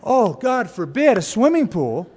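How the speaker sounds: clutch your pearls and gasp it out; mock horror. Shocked legalist mocks swimming pool.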